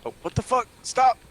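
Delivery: high, loud startled shout; startled yelp. Real, not acted. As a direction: yell it in sudden alarm. Reacting to game startled.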